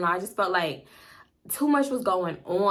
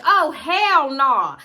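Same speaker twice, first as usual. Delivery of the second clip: hell nah; 6 semitones higher, 7 dB louder, much slower than usual. Retold or imitated. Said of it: "I'm like".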